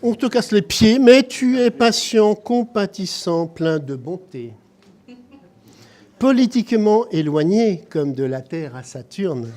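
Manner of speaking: loud declaimed birthday verse, some lines slow; loud ceremonial poem reading; poem recital. Acted. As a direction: read the tribute poem to the room, projecting. Reads birthday poem aloud.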